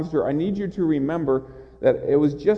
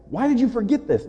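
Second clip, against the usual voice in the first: higher, sharper scolding question; mock rebuke; 8 semitones higher, faster than usual. Jesus wrongly imagined scolding Martha, higher sharp rebuke. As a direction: imitate the scolding you should not hear. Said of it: "not of rebuke".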